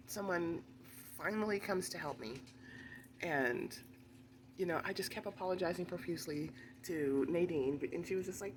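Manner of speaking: usual speaking voice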